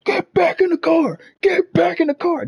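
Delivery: high, slow, forceful cop command; retold cop shouting get back in the car; barked order. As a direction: order him back with a gun drawn.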